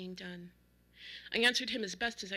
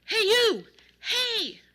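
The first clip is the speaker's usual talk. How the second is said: high, loud hail from down the street; sharp, high-pitched holler; shouting; 12 semitones higher, 12 dB louder, much slower than usual. A narrator's reading. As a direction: yell to get his attention. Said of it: "I heard two men shouting".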